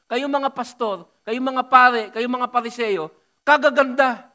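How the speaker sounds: preacher loudly rebuking pastors and priests; rising rebuke. Real, not acted. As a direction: hammer each group in turn.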